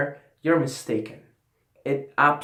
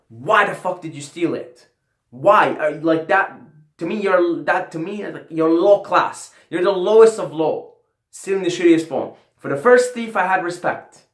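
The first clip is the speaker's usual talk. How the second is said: heated rant, louder and higher; 5 semitones higher, 5 dB louder than usual. Real, not acted. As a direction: vent at the thief, building anger. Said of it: "I was ranting".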